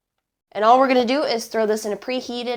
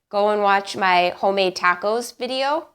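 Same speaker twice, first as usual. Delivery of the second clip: ordinary delivery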